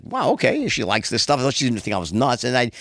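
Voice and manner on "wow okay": high, surprised mock wow; taken aback. Author surprised the editor likes it, high mock wow. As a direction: act pleasantly caught off guard. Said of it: "I was like".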